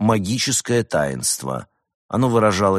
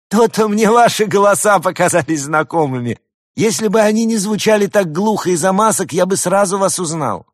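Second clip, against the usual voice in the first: triumphant; 13 semitones higher, 7 dB louder than usual. A narrator's reading. Stik laughing, recognizing merchants.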